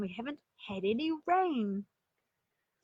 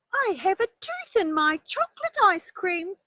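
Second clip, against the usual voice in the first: high, loud little bunny voice; a small child bursting to show off her tooth; excited little rabbit; 9 semitones higher, 9 dB louder than usual. Acted. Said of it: "said Little Rabbit".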